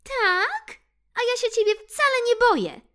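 high, loud, fast defiant squeak; defiant mouse. Acted. Mouse Ixi defies Bill, high fast squeak. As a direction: fire back fearlessly as the mouse. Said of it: "patrzyła na Billa".

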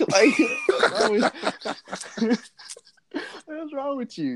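high-pitched, helpless laughing scold; laughing too hard to finish; weak with laughter. Retold or imitated. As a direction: try to scold while cracking up. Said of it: "we weak".

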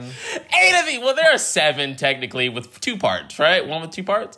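high, laughing disbelief. Real, not acted. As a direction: laugh while correcting yourself. Laughing argument continues.

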